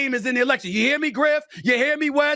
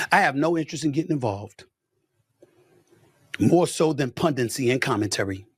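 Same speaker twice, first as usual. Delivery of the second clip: usual speaking voice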